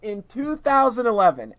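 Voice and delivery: high, loud, incredulous excitement; amazed outburst. Real, not acted. Fan marvelling to friends at the Contra announcement, amazed and loud.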